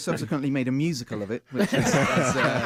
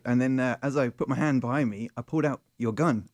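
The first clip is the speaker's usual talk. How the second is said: ordinary delivery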